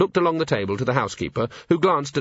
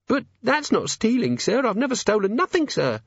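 put-on high, timid servant girl; nervous young maid; 12 semitones higher than usual. A narrator's reading. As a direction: stammer it out, frightened. Maid protests.

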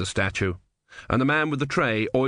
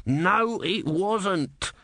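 character voice; 9 semitones higher, much slower than usual. Acted. Character voice in story reading.

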